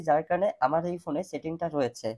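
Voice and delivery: usual speaking voice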